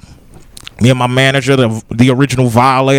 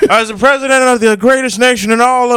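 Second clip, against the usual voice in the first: higher, lightly voiced old politician; Biden impression; 7 semitones higher, faster than usual. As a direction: grandiose old president voice. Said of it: "Biden sounds like".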